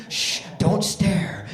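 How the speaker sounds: low, slow parental shush; hushing mother. Retold or imitated. Mom shushing her kid in the store line, low and slow. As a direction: draw out a low shush to a child. Mother hushes staring child.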